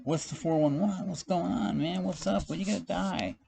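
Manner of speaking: high-pitched mock questioners; nosy people asking. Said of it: "like, people asking me".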